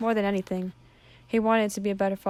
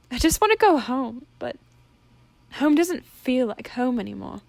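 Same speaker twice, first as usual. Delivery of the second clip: tearful; 4 semitones higher, 4 dB louder than usual. Acted. Crying daughter wants home.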